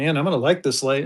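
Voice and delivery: ordinary talk, nothing marked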